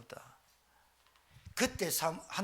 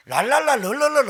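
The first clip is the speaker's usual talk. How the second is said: rattled-off la-la babble, fast and loud; fast tongues babble; 7 semitones higher, 11 dB louder than usual. Shown as a demonstration. 빨리 방언하던 옛 습관을 흉내 내며 빠르게 떠드는 소리. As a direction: mimic rushed speaking in tongues, high and loud. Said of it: "얼마나 빨리 하는지 몰라요".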